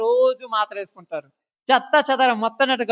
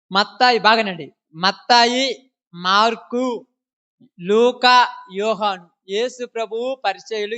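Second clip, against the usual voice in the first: ordinary talk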